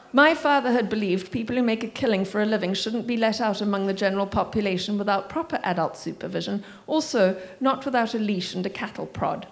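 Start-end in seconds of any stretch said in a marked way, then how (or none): none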